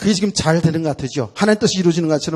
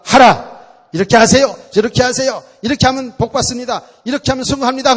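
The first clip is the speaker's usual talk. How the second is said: loud, high barked orders; commanding shout; 7 semitones higher, 8 dB louder than usual. Retold or imitated. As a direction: drill the rules into them. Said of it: "preachers ordering what to do". (설교자가 교인들에게 명령하듯 외침)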